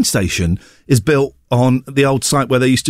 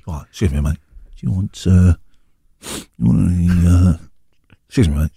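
low quick street dealer; 10 semitones lower, faster than usual. Pretend. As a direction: lean in like a seedy dealer. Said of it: "sound like a drug dealer".

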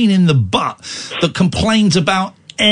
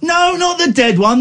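normal talking voice

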